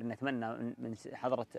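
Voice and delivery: ordinary talk, nothing marked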